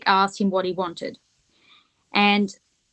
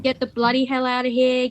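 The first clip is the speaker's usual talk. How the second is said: put-on British accent; 3 semitones higher than usual. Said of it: "My dad's British".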